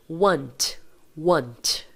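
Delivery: want with a crisp final t, no extra vowel; English want. Shown as a demonstration. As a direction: say want ending clean on t. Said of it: "pronuncia comigo aí".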